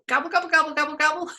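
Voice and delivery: high, rapid turkey gobbles; playful high-pitched gobble; turkey gobbling. Shown as a demonstration. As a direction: imitate a turkey for the kids. Imitates the toy turkey.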